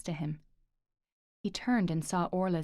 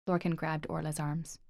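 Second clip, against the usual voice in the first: ordinary delivery, nothing marked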